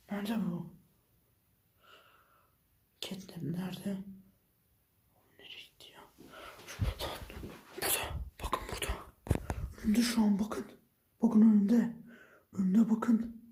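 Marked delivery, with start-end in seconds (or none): 3.0-8.2 barely audible, searching; whisper, afraid to be heard; very hushed search; nervous whisper. Real, not acted. Searching for the hidden monster. 8.4-8.9 hushed, pointing it out; whispered alert. Real, not acted. Monster spotted.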